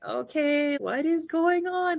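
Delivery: high, drawn-out bewilderment; puzzled impatience. Retold or imitated. Retelling impatience with long filming.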